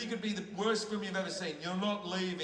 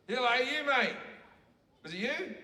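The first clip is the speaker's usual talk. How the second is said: calling out; 6 semitones higher than usual. Real, not acted. Calling to the fainted audience member.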